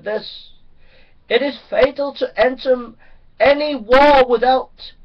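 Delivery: fiery sermon climax; preaching at full force. Acted. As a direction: thunder it from the pulpit.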